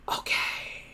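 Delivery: slow, eerie hissing whisper; whispering creature. Acted. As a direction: slither the words out slowly. Creature voiced.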